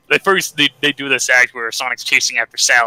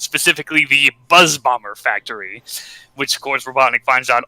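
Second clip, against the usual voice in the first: normal talking voice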